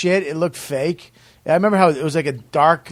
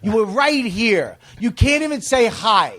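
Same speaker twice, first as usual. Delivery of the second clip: raised, half-hearted snapping at fans; imitating a fed-up comic snapping, pitched up; mock snap; 7 semitones higher than usual. Comic snapping at fans who ignore him, mock irritation. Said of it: "Gary would snap".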